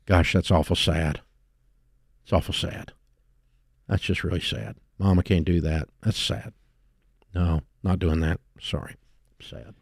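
soft, low, fading pity; softly mock-sorrowful, dying away to nothing; mock sad sigh. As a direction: shake your head and murmur it. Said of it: "shake your head and go".

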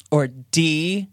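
usual speaking voice

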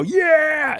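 high whooping yeah; excited yell. Retold or imitated. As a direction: cheer it out like a proud rocker. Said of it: "he's all".

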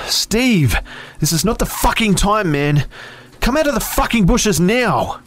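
high, loud furious order; angry yell. A narrator's reading. Angry narrator ordering Steve out of the bushes, loud high yell. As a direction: shout it out, strained and high. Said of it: "I was angry".

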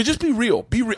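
high, pleading retold demand; a little upset. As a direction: urge them, mildly exasperated. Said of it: "I actually got a little upset".

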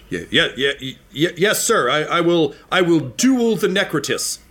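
stutter, then firm up into a vow; a lightly voiced young fighter finding his nerve; nervous soldier. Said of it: "straightens up and he says".

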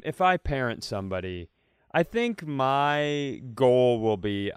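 ordinary talk

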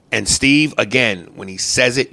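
usual speaking voice

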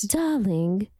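drawn-out imitation of a castmate with an accent; Lisa. Cast member imitating accented castmate, drawn-out endearment. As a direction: mimic her signature endearment. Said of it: "Can you do Lisa's or James?".

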